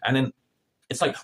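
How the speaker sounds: ordinary talk, nothing marked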